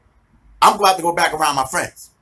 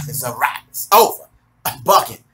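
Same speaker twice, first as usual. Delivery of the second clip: ordinary delivery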